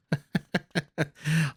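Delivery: high real chuckle; a delighted, amused chuckle; laugh. Real, not acted. Caster laughs at left-handed farmers.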